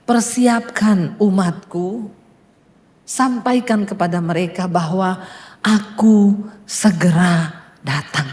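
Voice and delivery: low, slow, weighty divine voice; solemn voice of God. Retold or imitated. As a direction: speak as God giving a solemn warning, drawing out each word. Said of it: "Tuhan tuh bilang begini".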